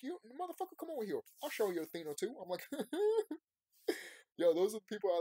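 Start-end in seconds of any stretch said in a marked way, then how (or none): 0.0-2.3 higher, teasing come-at-me voice; playful tough challenge. Retold or imitated. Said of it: "they be like". 2.7-3.4 high, loud delighted laugh; burst of laughter. Real, not acted. Laughs at own quote.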